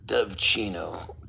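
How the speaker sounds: soft whispered name; whispering. Real, not acted. Reading a viewer's name.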